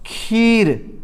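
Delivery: "खीर" as one drawn-out syllable; long vowel. Shown as a demonstration. Teacher giving a spelling example, "खीर" drawn out. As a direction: stretch the ee in "खीर". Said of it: "आप लिख रहे हैं".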